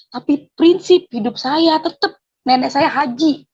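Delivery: usual speaking voice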